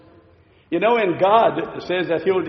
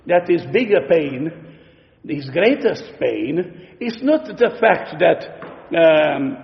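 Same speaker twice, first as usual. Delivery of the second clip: usual speaking voice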